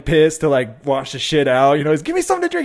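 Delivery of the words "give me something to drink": high, loud mock plea; hammed-up frantic yell; desperate demand. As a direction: imitate a panicked stoner begging. Acted stoner desperate for a drink.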